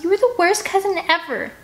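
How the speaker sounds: high furious shout; angry shout. Acted. Scarlet yelling at her cousin, high angry shout. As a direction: keep yelling at him.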